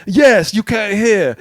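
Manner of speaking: terrible, drawn-out mock British accent; Bear Grylls. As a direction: badly imitate a British survival host, slowly. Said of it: "that's a terrible accent".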